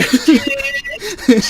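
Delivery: loud high party shout; party girl. Retold or imitated.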